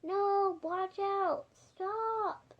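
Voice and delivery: high, urgent warning shouts; crowd shouting. A narrator's reading. Crowd warning of a bus coming at them, shouting. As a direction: shout a warning, drawing out each cry.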